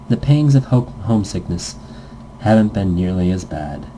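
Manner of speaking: ordinary delivery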